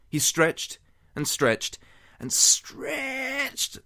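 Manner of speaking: building strain. Acted. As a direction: strain harder each time. Stretching building up.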